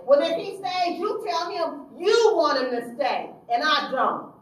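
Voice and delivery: loud, high, unbending; defiant anger. Retold or imitated. Counselor defying her supervisor, loud and unbending. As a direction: slam it down.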